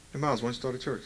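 low, hushed suggestion; quiet inner voice. Acted. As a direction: murmur it like a private thought. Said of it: "One day I heard a voice".

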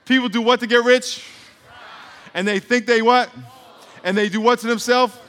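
high, loud questions to the crowd; rousing questions. Real, not acted. Preacher quizzing the congregation, high and loud. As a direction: pull the answer out of them.

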